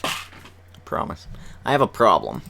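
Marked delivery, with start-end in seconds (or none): 0.0-1.3 unvoiced slow whisper of I promise. Real, not acted. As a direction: whisper it slowly, breathy.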